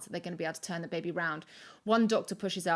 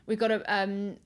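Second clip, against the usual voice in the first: ordinary talk, nothing marked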